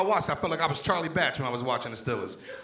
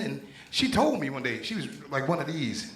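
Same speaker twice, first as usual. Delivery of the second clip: ordinary delivery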